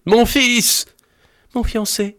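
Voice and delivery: high cries of joy and shock; two cries. A narrator's reading. Father and fiancée seeing Louis alive, high cries. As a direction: cry out at the sight of him. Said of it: "Ces deux cris".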